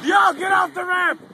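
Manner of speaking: loud, slow, high yell; shouting. Real, not acted. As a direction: yell at him to get off. Yelling at kid on ramp.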